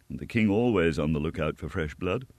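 ordinary delivery, nothing marked

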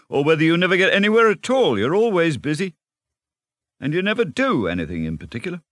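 high, excited, tumbling ramble; carried-away enthusiasm. A narrator's reading. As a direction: gush on eagerly, gathering speed. Rat enthuses about boating.

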